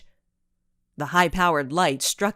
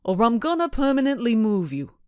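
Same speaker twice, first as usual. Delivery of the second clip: put-on thick New England accent; 5 semitones higher than usual. A narrator's reading. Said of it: "with a thick New England accent".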